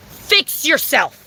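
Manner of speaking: each word dragged out slowly; slow emphatic. Real, not acted. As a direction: spell it out slowly like a scolding. Ranting at neighbor, slowed.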